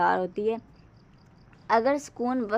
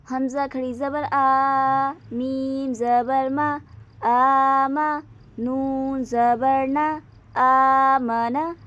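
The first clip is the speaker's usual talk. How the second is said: slow, louder spelling out of letters; spelling drill; 2 semitones higher, 4 dB louder, much slower than usual. Shown as a demonstration. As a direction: spell each sound slowly for a child. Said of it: "इसको हमने खींचना है". (बच्चों को धीरे हिज्जे कराते हुए)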